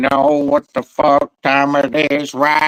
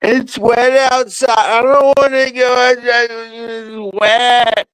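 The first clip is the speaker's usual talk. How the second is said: higher whiny pet voice; pet's voice; 9 semitones higher than usual. Pretend. Speaking for the wet pet, whiny and higher. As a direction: whine it like the wet pet refusing to go out.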